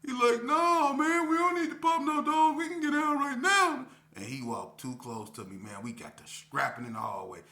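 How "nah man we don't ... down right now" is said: very high, very loud put-on shout; won't wait any longer; furious K P. K P to the narrator, furious high shout. K P demands fight.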